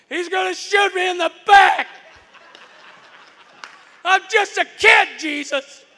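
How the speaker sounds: put-on high, loud terrified kid; boy fleeing down a driveway, panicked shout; panicked cry. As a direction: cry it out like a child sure he'll be shot. Scared kid's panicked thought.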